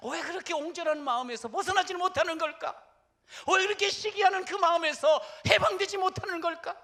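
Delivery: high, loud, building shout; fiery sermon shout; preaching climax. Real, not acted. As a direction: drive the question home at full force. Preacher's rising rhetorical climax.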